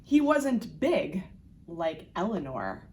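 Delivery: ordinary delivery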